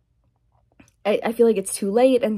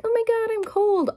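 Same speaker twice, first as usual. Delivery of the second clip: much higher mocking whine; mock cold person; 13 semitones higher than usual. Vlogger mocking people who always complain of cold, high whine. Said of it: "some people who are like".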